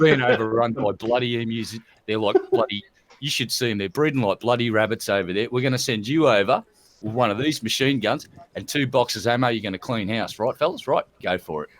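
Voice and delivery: a Prime Minister briefing war heroes, low broad Australian voice. Retold or imitated. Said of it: "He says".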